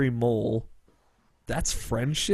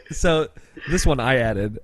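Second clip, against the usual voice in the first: usual speaking voice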